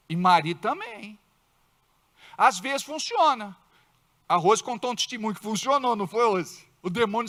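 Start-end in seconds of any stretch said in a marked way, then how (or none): none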